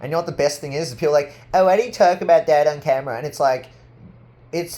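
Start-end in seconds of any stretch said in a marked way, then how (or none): 1.5-3.2 higher, louder, fast mock question; hammed-up critic voice. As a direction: parody the nagging critic.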